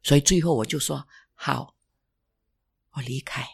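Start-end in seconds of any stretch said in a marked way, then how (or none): 1.3-3.4 soft, fading whisper of giving up; whispered surrender. Retold or imitated. Resigning before the church leaders, whispered. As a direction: let the words die to a whisper.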